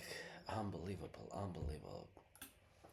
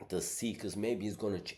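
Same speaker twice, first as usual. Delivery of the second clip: ordinary talk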